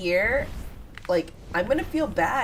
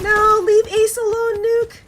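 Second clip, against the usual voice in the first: high, loud, pleading shout; 10 dB louder than usual. Real, not acted. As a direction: yell at them to back off. Mom sees her teammate attacked.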